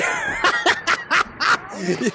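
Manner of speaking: high-pitched burst of laughter; long, high cackling laugh; big laugh. Real, not acted. Streamer tickled by a very excited fan, laughing.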